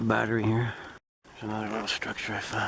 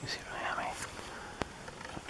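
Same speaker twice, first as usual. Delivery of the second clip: soft unvoiced whisper; 13 dB quieter than usual. Real, not acted. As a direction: hush it, barely breathing. Quiet in the woods.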